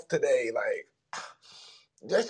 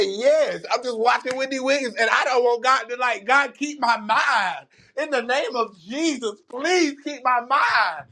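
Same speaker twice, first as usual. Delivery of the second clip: retold prayer, higher and slow, pushed from the chest; 10 semitones higher than usual. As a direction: plead it out earnestly, drawing out the words. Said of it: "I said that with my chest".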